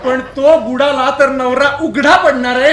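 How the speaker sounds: loud, indignant scolding; shouting. Acted. Husband berates wife over her business, shouting. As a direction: raise your voice and tell her off. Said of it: "अहो, तुम्ही किती ओरडताय".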